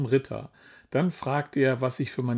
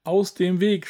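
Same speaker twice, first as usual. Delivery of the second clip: higher, mild storybook shout; urgent cry, pitched up; shouted command; 5 semitones higher, 4 dB louder than usual. A narrator's reading. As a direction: call out to clear the path. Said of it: "schrie ich".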